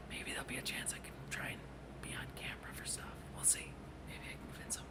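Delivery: hushed, secretive whisper; conspiratorial whisper. Real, not acted. Streamer confiding hopes of being on camera, whispering.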